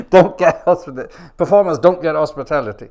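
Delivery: loud, disbelieving repeat through a laugh; incredulous outburst. Real, not acted. Repeating the organiser's rule, loud and incredulous. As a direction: hammer the words back in disbelief.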